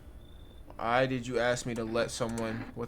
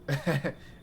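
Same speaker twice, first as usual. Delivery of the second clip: tipsy guffaw; 3 semitones higher than usual. Acted. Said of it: "You sound kinda tipsy".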